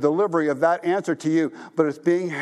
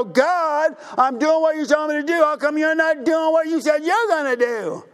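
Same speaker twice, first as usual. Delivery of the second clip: much higher and louder, a mock whining protest; whiny complaint to God; 12 semitones higher, 4 dB louder than usual. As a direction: play the indignant believer nagging God. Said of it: "and we go".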